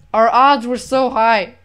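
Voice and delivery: ordinary talk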